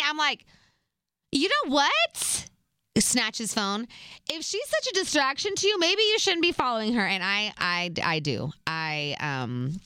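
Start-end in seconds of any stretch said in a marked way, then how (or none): 1.3-2.5 high, drawn-out "that's it" challenge; slow, pitched-up mock indignation; retold fed-up outburst. Girlfriend about to snatch his phone, fed-up challenge. Snapping at him in retold quarrel. 4.3-7.0 high-pitched pointed telling-off; sassy rebuke, pitched well up; retold indignation. Girlfriend confronting him over who he follows, indignant.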